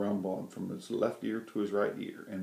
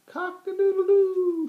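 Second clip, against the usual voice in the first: very high, loud crowing; loud high cock-a-doodle-doo; rooster crow; 12 dB louder, slower than usual. A narrator's reading. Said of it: "shouted the rooster".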